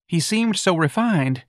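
high, slow, wistful office manager; a prim manager mourning lost refinement. Acted. Office manager laments Riley.

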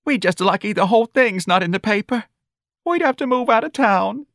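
high, wailing mother's lament; crying anew. A narrator's reading. Mother crying to her son over the scandal, tearful. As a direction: wail it high, as if crying afresh. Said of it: "Missus Riley cried anew".

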